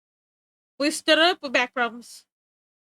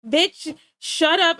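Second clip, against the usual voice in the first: louder and higher, a playful mock shout; mock yell; 5 semitones higher, 7 dB louder, much slower than usual. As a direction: snap at him like an annoyed viewer. Viewer voiced yelling at him.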